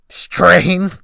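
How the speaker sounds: loud, high scornful snort of a word; snorted scornful echo. A narrator's reading. Old sailor scoffs at the narrator, loud snort. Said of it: "he snorted".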